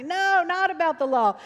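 cheering congregation. Retold or imitated. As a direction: imitate a crowd cheering back. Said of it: "they'd be cheering".

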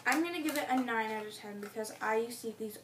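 speaking between bites; talking while chewing. Real, not acted. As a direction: mouth partly full of cookie. Seen 1: left speaker bit the cookie at -3s, holds it while talking.

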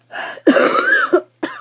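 loud rising cough; a sudden harsh cough. Real, not acted. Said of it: "that's unattractive".

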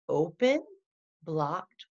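ordinary talk, nothing marked

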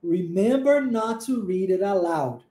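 slow "Remember not to read it aloud", with "read it aloud" run together; linked words. Shown as a demonstration. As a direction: link "read it aloud" into one smooth chain, slowly. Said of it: "como nós juntamos".

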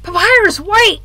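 high, loud call after someone running off. Acted. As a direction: shout to stop him from leaving. Calling after Papyrus.

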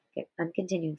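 usual speaking voice

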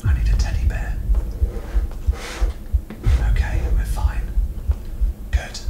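hushed, secretive whisper. Real, not acted. Player trying not to be caught, whispering. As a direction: whisper so the monster won't hear. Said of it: "'Cause the quieter I am".

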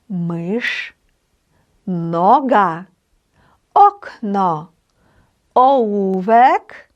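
slow, careful Polish pronunciation drill, one word at a time; model word reading. Shown as a demonstration. Teacher checking the reading together with learners, slow word-by-word model pronunciation. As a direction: read out each word separately and drawn out, with a pause after it. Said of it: "і ми з вами перевіримо разом".

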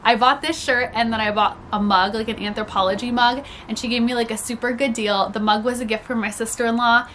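ordinary delivery

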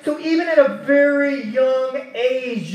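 ordinary delivery

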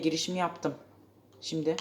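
ordinary talk

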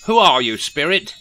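demanding. A narrator's reading. Rishi demands the ghost name herself, loudly. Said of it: "demanded Rishi Scrooge".

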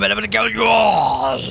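yelled threat; raging gamer. Real, not acted. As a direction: explode into a shout. Raging after repeated deaths.

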